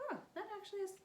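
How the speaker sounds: pleasantly surprised, squeaky; excited high voice. Retold or imitated. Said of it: "you might be like".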